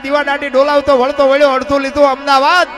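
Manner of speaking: loud, driving folk chant building from steady to full force; rhythmic poem recital. Acted. Folk performer chants Bhikhabhai's earthquake poem to the crowd, loud rhythmic chant. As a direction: pound out the verses like a bard over music. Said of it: "એ બાપુ એ અમારા ભીખાભાઈ રબારીએ લખ્યું છે".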